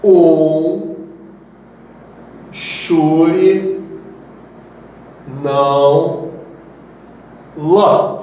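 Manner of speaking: drawn-out words with long pauses; spaced-out mnemonic. Shown as a demonstration. Teacher dictating a nonsense memory phrase to students, word by word. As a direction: give each word alone, stretched and deliberate. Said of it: "vamos ver uma frase aqui".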